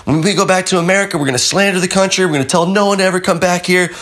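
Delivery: high, fast defiant threat; high, fast defiant rant. Retold or imitated. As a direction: rattle off threats to the cop. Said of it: "he was just like".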